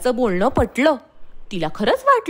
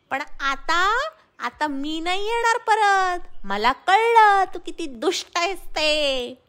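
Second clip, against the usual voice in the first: high, scornful monkey taunting from above; high monkey voice, sarcastic then reproachful; mocking monkey; 9 semitones higher than usual. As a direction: tell off the false friend from safety. Monkey scolds from the tree.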